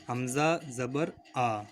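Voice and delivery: حمزہ زبر آ spelled out slowly; drawn out for learners to repeat; slow spelling drill. Shown as a demonstration. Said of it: "اس کو اس طرح سے پڑھیں گے". (آہستہ آہستہ ہجے)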